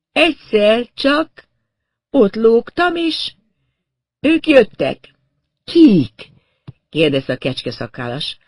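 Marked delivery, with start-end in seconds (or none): 0.1-5.1 high, loud, broken into fragments; stunned and halting, pitched far up; woman in shock. A narrator's reading. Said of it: "rendes sokkot kapott odakint". 5.6-6.3 sharp, loud, high one-word demand; raised, startled query; urgent question. A narrator's reading. Goatee man urgently questions her.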